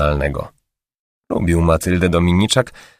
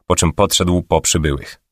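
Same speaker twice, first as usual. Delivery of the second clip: plain reading voice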